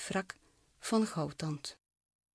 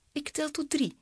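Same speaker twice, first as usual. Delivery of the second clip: higher, mildly raised cry; a restrained storybook shriek; 7 semitones higher than usual. A narrator's reading. Lili warning the narrator before the dive, shrieking mildly. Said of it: "gilde ze".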